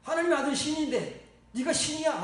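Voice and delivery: loud, high-pitched indignant challenge; acted outburst of scandalized accusers; outraged crowd. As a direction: imitate an angry mob raising an uproar. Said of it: "막 난리를 치는 거야".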